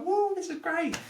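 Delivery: very high-pitched gleeful whoop; hammed-up wide-eyed wonder; mock elation. Acts out early enthusiasm for recovery.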